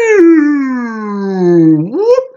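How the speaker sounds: a cartoon yelp sliding into a squeak; falling yelp. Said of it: "you hear Blofeld go down like".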